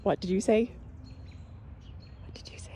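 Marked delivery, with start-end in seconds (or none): 2.2-2.7 soft unvoiced whisper. Shown as a demonstration. Owner whispering back to her parrot. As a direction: breathe it with no voice. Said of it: "Are you whispering to me?".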